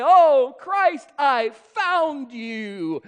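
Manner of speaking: high, loud "Oh", slow stretched "found"; gushing discovery, slightly hammed; eager convert. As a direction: believers proudly claiming they found Christ. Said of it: "they say".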